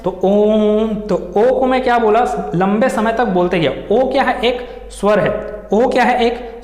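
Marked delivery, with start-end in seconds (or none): 0.2-0.9 ओम chanted with the ओ held; ओम sustained like a resonant chant; prolonged om. Shown as a demonstration. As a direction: intone ओम in a drawn-out chant. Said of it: "ओम नाद किया हूँ मैं".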